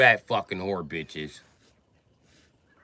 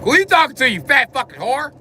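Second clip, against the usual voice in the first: high, loud angry snap; shaking with anger; 11 semitones higher, 5 dB louder than usual. Retold or imitated. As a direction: bark it at mom in fury.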